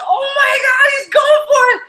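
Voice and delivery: high, loud cheering shout; townsfolk shouting. Retold or imitated. Townspeople at the river screaming in excitement. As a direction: scream it like a crowd cheering on a swimmer. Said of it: "the whole town ran to the river and said".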